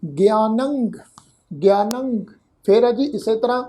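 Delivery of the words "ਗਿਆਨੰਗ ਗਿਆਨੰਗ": ਗਿਆਨੰਗ with sihari on ਗ and a nasal ending; nasal pronunciation. Shown as a demonstration. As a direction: say ਗਿਆਨੰਗ as gi-aa-nang, the final ਨੰ nasalised. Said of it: "ਟਿੱਪੀ ਦੀ ਮਾਤਰਾ ਦਾ ਨਾਸਕ ਉਚਾਰਨ ਹੋਏਗਾ". (ਗਿਆਨੰਗ नासिक उच्चारण के साथ)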